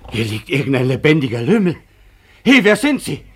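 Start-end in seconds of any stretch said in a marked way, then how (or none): 2.4-3.2 angry demand. Acted. Demanding answer from strange man.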